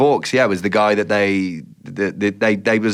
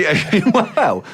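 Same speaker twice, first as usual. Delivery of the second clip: laughing; 11 semitones higher than usual. Real, not acted. Laughs while answering.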